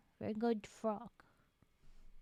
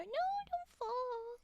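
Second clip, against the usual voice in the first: pleading no; 10 semitones higher, 3 dB quieter, slower than usual. Real, not acted. Begging frog not to fall.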